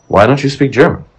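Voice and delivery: high, pointed mimic of the doctor's question; mimicking the doctor. Patient imitates doctor demanding why he speaks no German, high and pointed. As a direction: ask it like a smug doctor, voice up high. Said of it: "in Italian to me".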